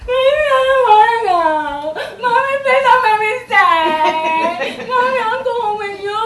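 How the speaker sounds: high, loud, drawn-out wail; mock child crying. Child clings to mommy on first day, hammed-up wailing. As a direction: bawl like a clingy little kid, hammed-up. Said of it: "the crying stops".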